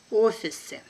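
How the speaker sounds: brisk, natural native speed; quick native pace. Shown as a demonstration. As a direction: say it fast like a native. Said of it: "second time will be quickly, like a native".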